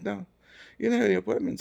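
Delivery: high imitation of a receptionist; high-voiced mock refusal; secretary voice. Voicing secretary refusing him.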